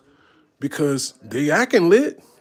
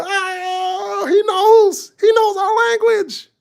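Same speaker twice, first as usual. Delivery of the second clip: much higher, louder, almost crying out; thrilled crowd cry; 8 dB louder, much slower than usual. Retold or imitated. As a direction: fans overjoyed that he speaks German. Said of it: "And they go".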